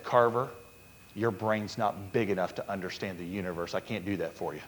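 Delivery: God gently answering Carver. Retold or imitated. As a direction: speak as God, low and calm. God voiced in story.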